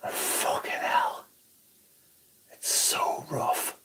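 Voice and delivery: slow, strained whisper through a stinging mouth; pained whisper. Real, not acted. After harsh mouthwash, slow pained whisper. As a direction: breathe it out, mouth burning.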